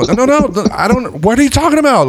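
very high mock-panicked protest; flustered denial. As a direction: protest helplessly in a squeaky voice. Retold flustered denial through window.

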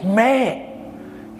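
high retold exclamation; seemingly mad. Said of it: "I thought he was getting mad".

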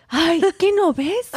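high, exasperated mom scolding; mock-scold like a mother at her wits' end; imitating scolding mom. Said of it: "Why are you screaming at me?".

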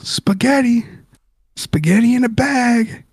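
mock street-seller pitch; imitating a vendor's advertising cry. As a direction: call it across the stadium like a food seller, without extra volume. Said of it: "I haven't heard this good advertising".

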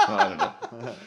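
ordinary talk

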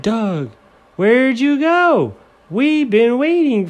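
high, slow character voice; high worried friend; Noah voice. Acted. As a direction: pitch up and stretch it out as Noah.

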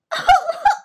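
put-on very high, loud child's whoop; happy squeal. A narrator's reading. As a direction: squeal with delight like a little princess. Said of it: "Putri berteriak senang".

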